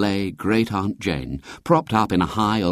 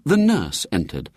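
usual speaking voice

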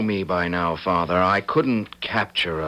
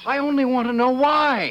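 much higher desperate plea; pleading cry. Acted. Son pleading with his furious father, high desperate cry. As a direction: beg him to explain.

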